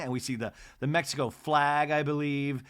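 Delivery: usual speaking voice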